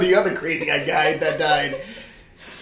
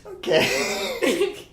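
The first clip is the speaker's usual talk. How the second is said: preschooler voice. Pretend. Still in kid voice.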